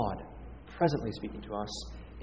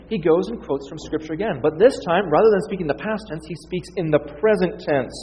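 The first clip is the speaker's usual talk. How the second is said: ordinary delivery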